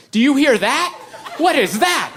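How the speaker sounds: high, urgent hypochondriac wife; imitated panicky questioning; mock alarm. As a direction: pitch it up in exaggerated worry. Wife alarmed by a wrist noise.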